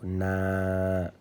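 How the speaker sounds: "na," with the a held very long; "na," as one steady sustained syllable; lengthened A. Shown as a demonstration. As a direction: draw out the a of "na,". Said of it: "alif qui sert d'outil d'allongement".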